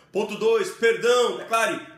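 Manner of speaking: high and loud, "perdão" ringing out. Shown as a demonstration. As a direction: proclaim "perdão" at full voice. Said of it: "levantar sua voz e declarar".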